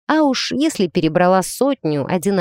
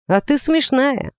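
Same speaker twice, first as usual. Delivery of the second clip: mildly mocking, higher and slow; smirking tease; 8 semitones higher than usual. A narrator's reading. A womanizer teases the newcomer as funny, smirking. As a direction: draw it out with a light smirk. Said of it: "хмыкнул бабник рядом".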